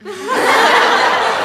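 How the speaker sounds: high laugh; real laughter; laughing at the high-heels punchline. Real, not acted. Laughs at own joke.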